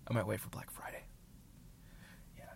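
murmured aside, barely voiced; whisper. Real, not acted. Musing to himself about buying AirPods, whispered. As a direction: whisper it to yourself.

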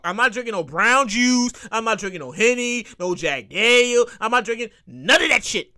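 ordinary talk, nothing marked

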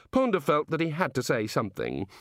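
ordinary delivery

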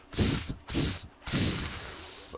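voiceless hissing spurts; web-shooting hiss. Retold or imitated. Daughter doing the Spider-Man thing, hissing spurts. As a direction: imitate webs shooting with soft hisses. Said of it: "the sound that spiders make when they shoot webs".

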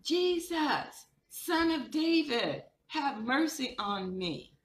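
high, drawn-out cry for mercy; calling out. Retold or imitated. Blind beggar crying out to Jesus, high and drawn out. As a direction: call out to Jesus from the roadside. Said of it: "he called out to him".

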